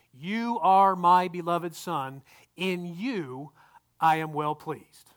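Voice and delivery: slow, measured reading, "You are" weighted; spaced-out verse with deliberate pauses; emphatic scripture reading. Shown as a demonstration. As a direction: read it out slowly, phrase by phrase. Reading the contrasting verse aloud.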